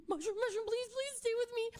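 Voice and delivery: high, pleading voice, near tears; desperate pleading. Retold or imitated. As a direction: beg the cat to stay alive, high and urgent. Pleading to dying cat.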